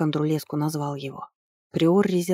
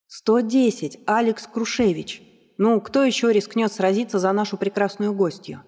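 ringmaster; 4 semitones higher than usual. Acted. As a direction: stretch the name for the crowd. Host announces the bid.

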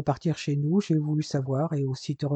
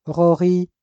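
loud hail across the room; a hearty greeting call; calling out a name; 5 dB louder than usual. Acted.

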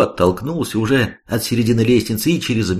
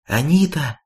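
sudden cry; 7 semitones higher than usual. A narrator's reading. Girl suddenly spots wounded Anita, higher, restrained cry. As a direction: cry out suddenly, held back in volume. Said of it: "и вдруг вскрикнула".

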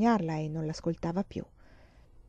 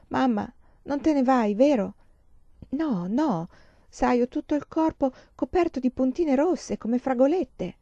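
put-on small, higher child's voice, drowsy with fever; feverish little girl; 5 semitones higher than usual. Sick girl asking her mother to stay, higher child voice. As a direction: voice the sick child half asleep.

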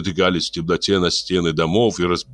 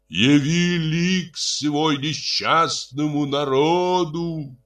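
priest's invocation; 9 semitones higher, much slower than usual. A narrator's reading. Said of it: "воскликнул с террасы Херихор".

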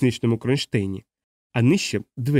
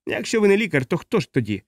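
pitched up at first, then dropping; suspicious; 7 semitones higher than usual. A narrator's reading. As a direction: question him with suspicion. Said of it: "спитав він із підозрою в голосі". (з підозрою в голосі)

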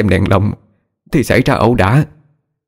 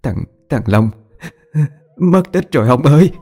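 acted tearful wail, pitch climbing high; stammer, then wail the bad news; distraught mother crying to her husband; sobbing; 7 semitones higher than usual. A narrator's reading. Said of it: "cứ thế mà khóc lên nức nở".

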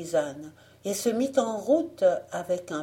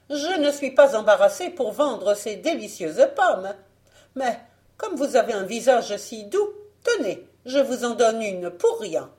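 higher, animated seller's voice; coaxing false peasant; 4 semitones higher, 6 dB louder than usual. Acted. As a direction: coax them into taking a free apple. Fausse paysanne cajole Blanche.

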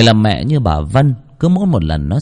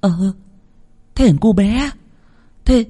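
high, hurried worried aunt; panicked; 13 semitones higher than usual. A narrator's reading. Worried mother asking the girl about her son, panicked. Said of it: "thì hốt hoảng".

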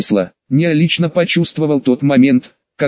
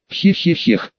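otherworldly chuckle; 2 semitones higher, slower than usual. Acted. Demon chuckles in Nea's head.

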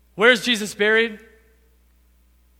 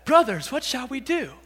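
mild, casual question; 4 semitones higher than usual. Shown as a demonstration. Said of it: "Now I say it like that".

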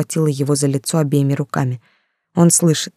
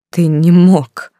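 a slow, louder cry that cracks; breaking voice; 2 semitones higher, 6 dB louder, much slower than usual. A narrator's reading. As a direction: let the voice break on it. Голос сорвался. (голос срывается от отчаяния)

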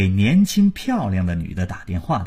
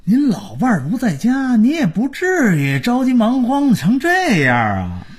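taunting; 11 semitones higher than usual. A narrator's reading. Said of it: "马家儿子诚心气他".

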